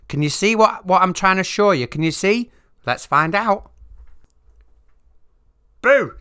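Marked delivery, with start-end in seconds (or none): 5.8-6.1 sudden jump-scare shout; startling boo. Pretend. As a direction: shout it to make them jump. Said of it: "Did I scare you?".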